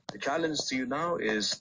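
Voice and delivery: normal talking voice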